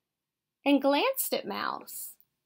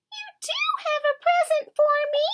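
high, fast excited squeak; tiny mouse bursting out in delight; crying out as Mouse; 5 dB louder, faster than usual. A narrator's reading. Mouse leaping up at the mantel, crying out squeakily. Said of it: "he cried".

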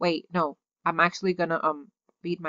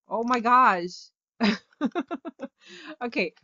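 normal talking voice

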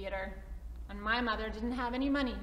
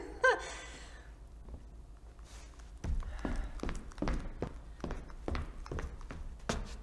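nervous laugh; 12 semitones higher, 4 dB quieter than usual. Acted.

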